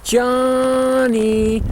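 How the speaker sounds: high, drawn-out call falling off; lost call. Shown as a demonstration. Demonstrating the lost calf call, high then falling. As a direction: hold it then let it fall at the end. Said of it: "starts out medium".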